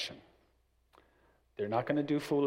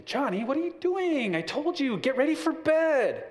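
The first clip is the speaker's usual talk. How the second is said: much higher, louder scolding of Johnny; exasperated mom; 13 semitones higher, 5 dB louder than usual. Retold or imitated. Mom at the door scolds dawdling son, exasperated. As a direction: scold him like a fed-up mother. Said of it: "Her voice started to escalate".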